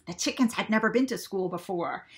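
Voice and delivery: ordinary delivery, nothing marked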